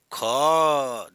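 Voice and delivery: ख with a strong loud puff of breath; aspirated demo. Shown as a demonstration. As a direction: push air hard through ख. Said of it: "देखो महाप्राण में ख".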